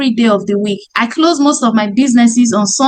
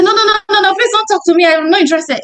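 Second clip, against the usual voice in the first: higher, brushing off a salesperson; rude prospect; 6 semitones higher, much faster than usual. Acted. Prospect rudely refusing the salesperson, higher and irritated. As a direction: wave the caller away, irritated and higher. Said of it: "Person say".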